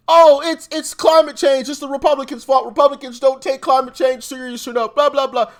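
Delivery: loud, high mock outrage; mocking imitation. Host parroting Democrats blaming Republicans for disasters, mocking imitation. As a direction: parrot a talking point, high and running on into blahs. Mimics blame after disasters.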